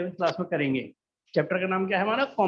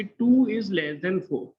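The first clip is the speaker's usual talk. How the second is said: slow example reading; 3 dB louder, much slower than usual. Shown as a demonstration. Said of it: "जैसे मैं कहूँ".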